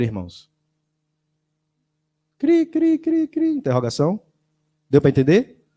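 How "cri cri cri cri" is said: high squeaky cricket chirp imitation. Preacher mocks the silent church with high cricket chirps.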